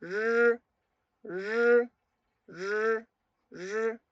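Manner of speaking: "R" drawn out, lightly vibrating, no roll; softly buzzed R. Shown as a demonstration. Teacher lengthening the sound for learners, soft buzzed "R". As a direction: hold a high, level buzzing "R", tongue back. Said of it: "haciéndola vibrar un poco".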